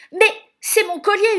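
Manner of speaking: high, very loud outraged shout; enraged yell. A narrator's reading. Javotte seeing her necklace on Cinderella, yelling in rage. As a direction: yell in jealous fury. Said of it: "hurle Javotte".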